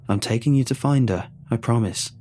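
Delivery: ordinary delivery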